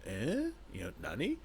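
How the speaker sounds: high, puzzled anime huh; mock anime bewilderment; chibi confusion. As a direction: go blank and baffled like a cartoon. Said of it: "the little chibi face of".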